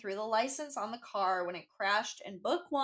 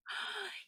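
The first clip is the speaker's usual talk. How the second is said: high, sudden gasp of shock; sharp gasp; 9 semitones higher, 11 dB quieter than usual. Real, not acted. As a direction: gasp like hearing juicy gossip. Reacts to ex-girlfriend reveal.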